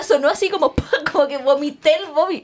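laughing through the words. Real, not acted. Telling her friend about her embarrassing sob, laughing. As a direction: giggle as you describe the ugly sob.

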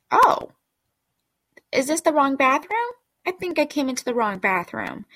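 high, questioning imitation of a puzzled lady; mock surprise. Lady walking in on strangers, imitated in high mock surprise. Acting out lady's first reaction.

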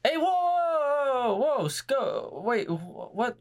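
high, drawn-out startled whoa; shocked. Real, not acted. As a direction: caught totally off guard. Shocked by surprise gifted subs.

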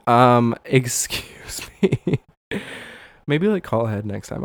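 loud, drawn-out mock disbelief; hammed-up offended reaction; imitated taken-aback clerk. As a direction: stretch out an incredulous "excuse me". Acts out store clerk taken aback.

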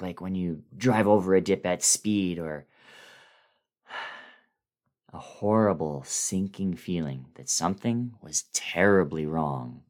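ordinary delivery

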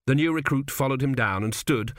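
ordinary delivery

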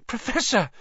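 put-on high, startled gasp of a boy; shocked, high-pitched exclamation. A narrator's reading. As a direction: gasp it out in alarm. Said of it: "Harry gasped".

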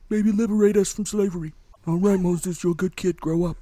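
hammed-up gangster offering a favor; Godfather impression. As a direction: play a mafia don making a deal. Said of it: "She turns into the Godfather".

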